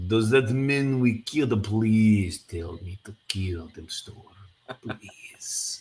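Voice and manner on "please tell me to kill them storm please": slow, begging, fading to whisper; hammy pleading. Acted. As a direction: beg eagerly, hammed up. Begging to kill.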